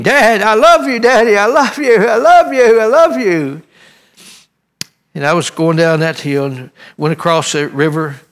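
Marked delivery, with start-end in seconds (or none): 0.0-3.6 high, loud desperate cries out the window; shouting love from a truck window, higher and louder; screaming. Retold or imitated. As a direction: yell it with everything, pleading. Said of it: "I was just screaming".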